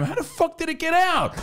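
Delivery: very high, incredulous outburst; hammed-up astonishment; mock disbelief. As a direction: squeal it in comic bafflement. Reacting to dog in retold story.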